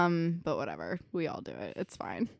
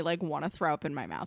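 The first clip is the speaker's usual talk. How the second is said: ordinary delivery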